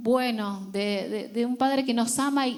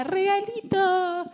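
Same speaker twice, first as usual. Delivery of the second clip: put-on high, slow little boy's call; drawn-out childish call; small child calling; 8 semitones higher, slower than usual. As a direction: call out like a three-year-old greeting mom. Little son calling at door.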